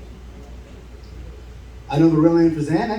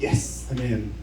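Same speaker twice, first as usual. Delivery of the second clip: quiet triumph; 6 semitones lower, 8 dB quieter, slower than usual. Retold or imitated. Triumphant inner whisper.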